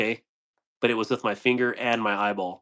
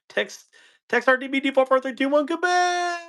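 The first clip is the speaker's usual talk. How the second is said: mock ad voice; 13 semitones higher than usual. Mimicking a TV ad read.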